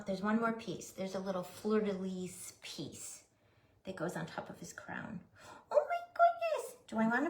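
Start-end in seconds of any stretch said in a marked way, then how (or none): 5.7-6.8 surprised squeal. Real, not acted. Reacts to the nose piece.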